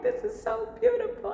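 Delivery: high, delighted gush; very high, overjoyed gush. Real, not acted. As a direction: melt with joy. Overjoyed by the crown gift.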